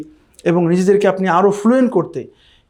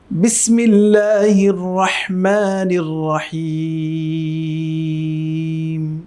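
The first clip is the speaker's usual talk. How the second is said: drawn-out melodic Quran recitation; slow recitation; much slower than usual. Shown as a demonstration. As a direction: chant it slowly like tilawat. (ধীর সুরেলা তিলাওয়াত)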